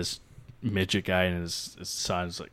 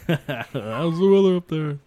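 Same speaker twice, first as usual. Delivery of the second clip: higher, louder, drawn-out mocking call; 8 semitones higher, 7 dB louder, slower than usual. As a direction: stretch it out as if calling up to a giant. Mocks the question, calling upward.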